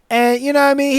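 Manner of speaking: normal talking voice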